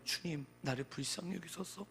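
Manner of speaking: ordinary delivery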